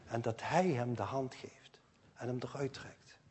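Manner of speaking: hushed whisper of Jesus pulling him out. Real, not acted. Climax of the rescue story.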